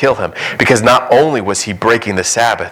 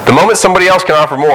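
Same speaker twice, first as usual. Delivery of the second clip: hurried aside; 8 semitones higher, 4 dB louder, much faster than usual. Acted. Mimicking fickle believer.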